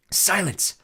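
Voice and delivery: sudden imperious snarl; snarled command. A narrator's reading. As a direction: snarl for silence. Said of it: "Medusa snarled".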